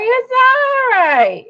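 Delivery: high, slow mock protest; exasperated retort. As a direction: drag out the impatient objection. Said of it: "I'm like".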